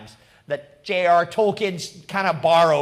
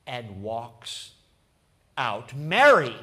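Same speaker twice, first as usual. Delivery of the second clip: usual speaking voice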